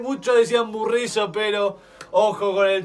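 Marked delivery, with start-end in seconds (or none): none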